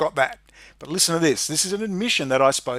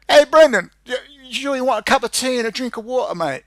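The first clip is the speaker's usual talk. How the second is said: much higher, louder English copper accent, mock friendly; English bobby accent; 9 semitones higher, 4 dB louder than usual. English cop offering tea after the tirade, English accent. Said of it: "the accent after this tirade".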